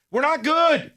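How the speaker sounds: worked-up sports host's yell; real yell. Real, not acted. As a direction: burst out with it, pitched well up. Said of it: "I'm not yelling at you".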